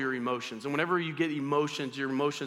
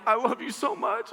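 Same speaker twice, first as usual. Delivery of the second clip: higher, hammed-up tearful voice; mock blubbering; 6 semitones higher than usual. Husband to wife after a sad movie, mock blubbering. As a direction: imitate breaking down as you speak. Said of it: "I know if I start talking".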